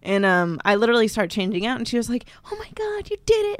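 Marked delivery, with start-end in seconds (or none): 2.5-3.6 hushed high-pitched mimicked delight; imitating sweet congratulation. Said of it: "she was so sweet".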